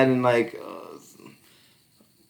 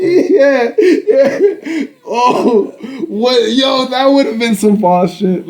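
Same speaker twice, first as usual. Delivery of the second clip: higher, louder, still laughing; excited; 12 semitones higher, 7 dB louder, much slower than usual. Real, not acted. Riffing with friend, excited. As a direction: hype it up.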